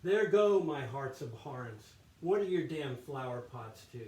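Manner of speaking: resentful monk growling at his rival; acted-out soliloquy. Monk cursing Brother Lawrence under the poem, acted grumbling. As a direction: open loud on the curse, then let it sink. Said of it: "try to act this out".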